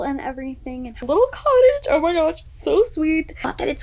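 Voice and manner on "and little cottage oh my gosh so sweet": high, loud burst of delight; delighted gushing. Real, not acted. Reader gushes over the couple's little cottage, delighted gushing. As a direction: squeal over something adorably sweet.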